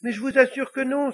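high, loud little devil's protest; crying out. Acted. Little devil denying it to the Pope, crying out high. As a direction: protest loudly in a small high voice. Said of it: "s'écria le petit diable".

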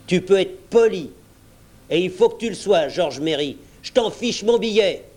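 indignant telling-off; stern demand. A narrator's reading. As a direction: put him firmly in his place. Silver demands respect from George.

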